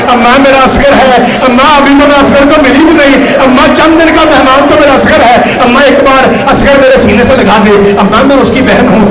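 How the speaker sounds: high, loud imitated wailing; anguished pleading. Sister begging to hold baby Asghar, imitated anguished wail. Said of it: "تڑپ کے صغریٰ کہتی ہے".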